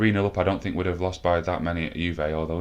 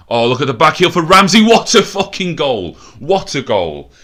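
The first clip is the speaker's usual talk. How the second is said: much higher, much louder ecstatic roar; goal celebration; 9 semitones higher, 12 dB louder than usual. Real, not acted. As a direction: roar it as the ball hits the net. Team scores a great goal.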